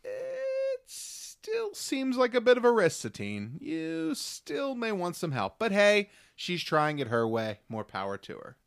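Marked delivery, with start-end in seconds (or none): 0.0-5.6 very high, drawn-out hesitant singsong; mock doubtful. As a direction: stretch it out high, gently sceptical. Mock doubtful advice addressed to Satine.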